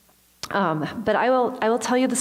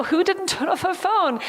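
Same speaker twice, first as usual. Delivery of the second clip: sheepish self-blame; 11 semitones higher than usual. Retold or imitated. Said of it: "And I'm like".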